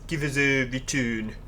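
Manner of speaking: lightly imitated Stoke woman, drawn out; slow mimicry of a Stoke accent. Player mimicking a woman passenger from Stoke, slow light Stoke accent. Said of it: "it's the woman that says".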